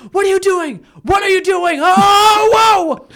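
high, loud imitated screams; mock screaming woman. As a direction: shriek like the panicked lady. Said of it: "She dead ass screamed".

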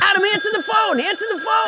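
high-pitched, pestering repeated order; insistent nagging. Acted. Co-host cutting off the host's protest, high nagging. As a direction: nag him in a high, sustained call. Nagging Adam to answer phone.

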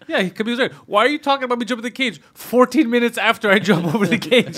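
high, exasperated mock complaint; Khabib impression. Fighter complaining minutes after jumping the cage, exasperated. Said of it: "he's like".

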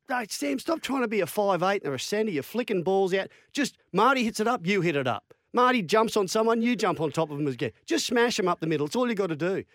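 exasperated coach's lecture, pitched high; Wayne Bennett. Retold or imitated. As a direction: scold a player with simple, insistent orders. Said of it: "'cause he's pretty harsh".

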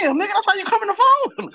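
very high, loud squeal of disbelief; mock outrage. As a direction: imitate a scandalized buddy on the phone. Friend's shocked reply, acted out.

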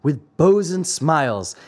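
ordinary delivery

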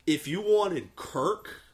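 ordinary talk, nothing marked

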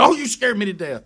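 high-pitched startled voice. Retold or imitated. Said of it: "Was in that high pitch".

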